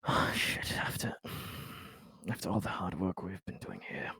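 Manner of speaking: muttered under the breath, barely voiced; hushed dismay. Real, not acted. Player dismayed by the leak, half-whispered. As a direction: let it slip out in a dismayed hush. Player reacts to betrayal news.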